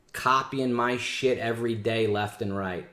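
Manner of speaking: ordinary talk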